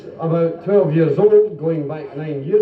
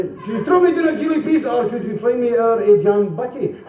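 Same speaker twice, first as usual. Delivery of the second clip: put-on higher, pleading child voice with a laugh; child asking up; 6 semitones higher than usual. Child asks mother for a jam butty, higher, laughing. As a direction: ask like a cheeky hungry kid.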